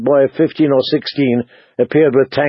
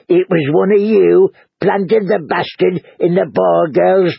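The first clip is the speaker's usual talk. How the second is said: higher, indignant innkeeper voice; accusing husband; 5 semitones higher, slower than usual. Acted. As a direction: rant at the harper, aggrieved.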